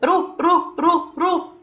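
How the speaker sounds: soft repeated رو with rolled r; letter sound demo. Shown as a demonstration. Teacher sounding out ra with damma, softly. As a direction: quietly roll رو رو. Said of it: "وصوته".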